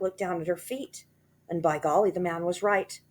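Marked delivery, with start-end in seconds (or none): none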